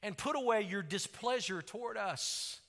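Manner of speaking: loud, pleading psalm reading, slowing at the end; crying out to God. Acted. Pastor reading the psalmist's plea, loud and slowing. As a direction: beg God on behalf of the people. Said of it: "the psalmist is crying out to God".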